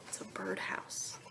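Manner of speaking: soft secret whisper; whispering the answer. Real, not acted. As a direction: let them in on a secret. Secret reveal to students.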